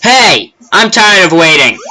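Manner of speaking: put-on an impatient kid shouting to be served; impatient kid yelling.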